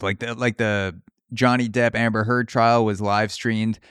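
ordinary talk, nothing marked

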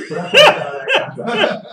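laughing it out, loud and high; punchline through a real laugh; loud laughing payoff. Real, not acted. Laughing at his own story.

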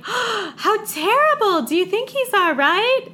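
high, slow, loud fake-concerned voice; mock concern. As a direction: ham up a shocked bystander.

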